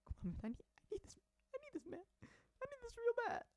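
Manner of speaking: high, hammed-up crying beg; mock sobbing plea. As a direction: whine it like a fake sob. Fake crying begging.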